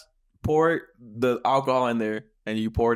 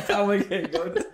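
laughing imitation; 7 semitones higher than usual. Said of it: "Bro, you're not".

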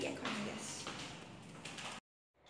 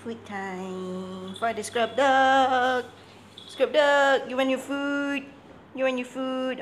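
much louder, slow call to the dog; loud sing-song call; 5 semitones higher, 11 dB louder, slower than usual. Real, not acted. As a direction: call the scrub dog loudly to food. Calling dog to food.

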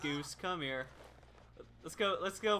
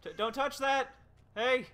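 mock yell; 5 semitones higher, 4 dB louder than usual. Yelling at survivor at generator.

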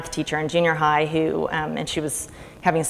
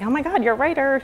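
surprised cousin; 9 semitones higher than usual. Retold or imitated.

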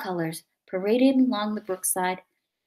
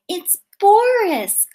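higher, louder, slow cry of relief; joyful cry; 11 semitones higher, 9 dB louder, much slower than usual. A narrator's reading. Mother cries out on hearing her lost son Boris. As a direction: call out his name in disbelief and joy. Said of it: "cried his mother".

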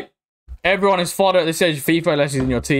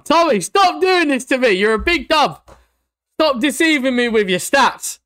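mock scolding; 7 semitones higher than usual. Mock scolding a player card.

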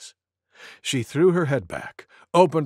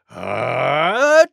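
loud mock sneeze; 5 dB louder than usual. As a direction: pretend achoo with a rising build-up. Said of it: "much louder than that".

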